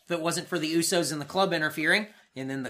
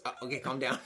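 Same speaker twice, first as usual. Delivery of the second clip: unbothered brush-off; 5 semitones lower, 9 dB quieter, faster than usual. Retold or imitated. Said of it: "Cass just goes".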